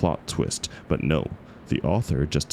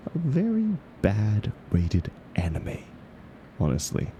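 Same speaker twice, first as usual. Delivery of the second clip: a quick snide whisper, higher; whispered aside; 5 semitones higher than usual. Acted. Mocking the book's title to her club, in a whispered aside. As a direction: hiss it out of the side of the mouth as a jab.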